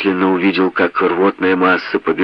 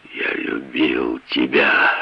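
heartbroken; 5 semitones lower, 4 dB quieter, much slower than usual. Acted. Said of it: "Он зарыдал".

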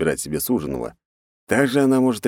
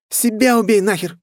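high, loud shout back; 4 dB louder than usual. Acted. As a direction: yell back at the attacker. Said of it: "выкрикнула Катя". (выкрикнуть)

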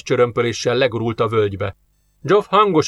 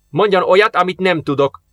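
irritated, higher-pitched retort; grumbling; 6 semitones higher, 3 dB louder than usual. A narrator's reading. Big Russian to nervous companion, grumbling. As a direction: grumble back, fed up. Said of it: "morgolódott a megtermett orosz".

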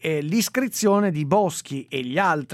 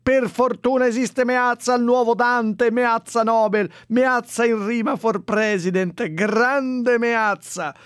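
higher, louder gushing praise; mock fan praise; 6 semitones higher, 5 dB louder, slower than usual. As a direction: cheer like an adoring fan. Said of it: "un profluvio di".

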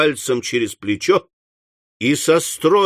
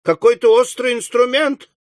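high-pitched flustered complaint; flustered, indignant outcry; agitated alarm; 9 semitones higher than usual. Acted. Indignant after being jabbed in leg.

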